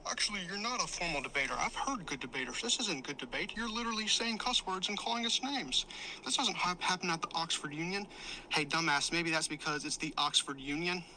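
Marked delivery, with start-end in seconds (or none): 0.1-8.1 mocking imitation. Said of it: "now he's trying to play the ground".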